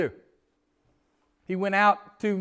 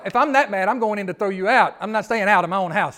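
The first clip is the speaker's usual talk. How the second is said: mock angry son; 8 dB louder than usual. Preacher voicing angry son.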